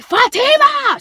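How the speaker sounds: high, loud calling her daughter's name; yell. Retold or imitated. Mother yelling for her daughter, high and loud. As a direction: call the name out sharply across the room. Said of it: "she yelled".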